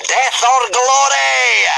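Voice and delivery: slow, high, loud shout; battle cry. Acted. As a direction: bellow it like a war cry. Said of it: "yelled Gobber".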